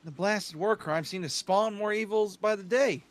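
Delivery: put-on a light Texan accent, slow. Crom musing on war in a light Texan accent. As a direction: drawl it slow like a Texan lord. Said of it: "you became Texan all of a sudden".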